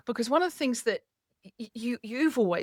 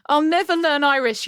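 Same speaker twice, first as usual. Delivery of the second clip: higher, louder, through a laugh; 8 semitones higher, 9 dB louder than usual. Real, not acted. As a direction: laugh as you admit defeat. Laughing at the teasing about accent.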